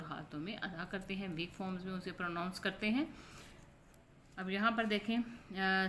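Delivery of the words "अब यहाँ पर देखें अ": ordinary talk, nothing marked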